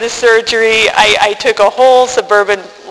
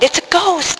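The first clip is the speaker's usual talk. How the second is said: frightened cry; 10 semitones higher than usual. Acted. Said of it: "They cried out in fear".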